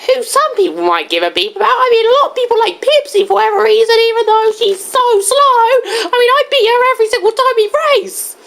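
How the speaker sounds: high, quick cartoon chatter; fast, high little game character; Diddy Kong. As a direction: boast in a high-pitched character voice. Plays Diddy Kong boasting.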